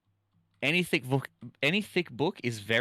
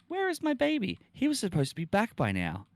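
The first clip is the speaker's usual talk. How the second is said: put-on much higher, fretful girl voice; worried girlfriend; 12 semitones higher, 3 dB louder than usual. Streamer voices the girl waiting for her boyfriend, high and fretful.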